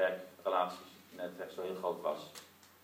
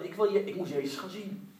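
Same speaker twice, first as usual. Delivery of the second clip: higher, urgent determined voice; determined inner voice; 11 semitones higher, 5 dB louder than usual. Retold or imitated. Blind man telling himself he must see Jesus, urgent and higher. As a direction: voice his stubborn resolve. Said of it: "hij zei tegen zichzelf".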